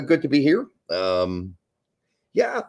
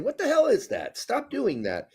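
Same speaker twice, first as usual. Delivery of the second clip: exasperated; 11 semitones higher than usual. Real, not acted. Rant after mimicking the performers.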